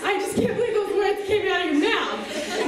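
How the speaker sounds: high, incredulous comeback with a laugh; indignant retort. Retold or imitated. Girl answering rude kid who repeated himself, high mock indignation.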